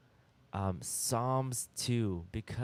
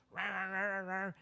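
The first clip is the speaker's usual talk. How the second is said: higher playful mock bark; imitating a barking dog; 7 semitones higher, faster than usual. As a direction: yap like a little dog. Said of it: "like barking at you".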